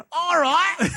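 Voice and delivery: high, loud mock British; hammed-up chimney-sweep British; Johnny Lee Miller. Employee agreeing to watch the store, mock British accent. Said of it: "uber British he's ever sounded".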